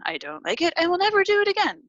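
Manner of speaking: quick, high-pitched automatic no; programmed refusal. Acted. Acting out a pre-programmed negative response.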